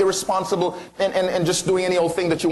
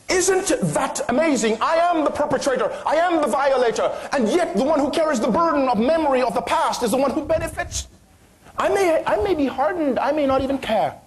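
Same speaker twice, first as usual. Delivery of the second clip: preaching at full force; 8 semitones higher than usual. Acted. Preacher rising in fervour.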